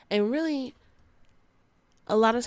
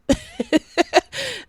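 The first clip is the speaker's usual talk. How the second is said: loud high squealing laugh; 6 dB louder than usual. Real, not acted. Host laughing at her chicken wing joke, high squeal. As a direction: burst out in a shrill laugh.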